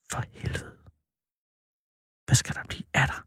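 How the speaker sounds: hushed, voiceless whisper; whispered worry. Acted. Man worrying alone about the boy Victor, whispering. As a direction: whisper it to yourself, very softly.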